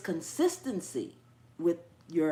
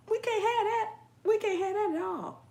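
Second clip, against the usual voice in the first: much higher, playful no-way; high mock dismay; 12 semitones higher than usual. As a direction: squeal it in mock outrage. Repeats the phrase, then drops.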